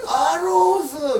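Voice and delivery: 高く大きく、ゆっくり笑い声で伸ばす; 爆笑しながら機種名を叫ぶ; 笑いながら叫ぶ. Retold or imitated. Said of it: "みたいな". (笑いながら高い声で叫ぶ)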